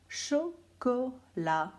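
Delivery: very slow, syllable by syllable chocolat; slow, stretched. Shown as a demonstration. Teacher dictating words slowly to children. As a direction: stretch each syllable of lavabo. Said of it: "teacher dictating three words".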